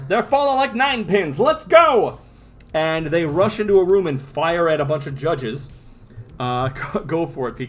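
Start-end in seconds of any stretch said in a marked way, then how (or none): 0.1-2.2 high, rising gangster call; rallying cry. Acted. Gunmen about to rush the judges, rallying cry. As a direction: urge the gang on, ending loud. Gunmen urging each other to attack.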